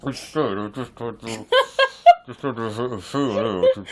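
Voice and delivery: talking through a mouthful of orange; very slow, chewing while speaking; mouth full. Real, not acted. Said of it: "got an orange skin in his mouth".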